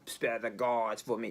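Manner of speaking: a hammed-up wannabe British epic-movie accent; mock vague British accent. Reviewer mocks the film's odd accents in a mock British accent. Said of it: "some type of weird accent like".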